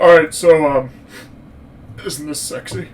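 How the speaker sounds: lightly voiced clumsy seducer, halting; Connery. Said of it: "And he's like".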